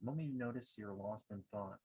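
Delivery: guided meditation. Acted. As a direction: guide listeners gently inward, leaving long pauses.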